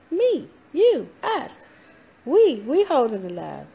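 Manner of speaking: lifted high and bright with conviction; emphatic answer. Acted. Coach answering her own question to the audience, high and emphatic. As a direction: hit it like the obvious answer.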